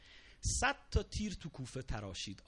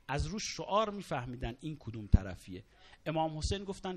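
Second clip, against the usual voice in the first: ordinary talk, nothing marked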